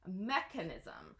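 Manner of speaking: Mechanism said with a hard k, not ch; Mechanism, stress on MEK; hard k demo. Shown as a demonstration. Teacher models Mechanism with a hard k. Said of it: "like a hard K sound".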